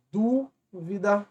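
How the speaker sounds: dúvida devagar e mais agudo no dú; stressed syllable shown. Shown as a demonstration. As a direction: alongue dúvida subindo no dú. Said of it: "é uma proparoxítona". (dúvida lenta, antepenúltima em destaque)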